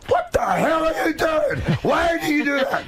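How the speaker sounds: high shouted mock-angry mom; imitating mom's shrill scolding yell. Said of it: "so it would be like".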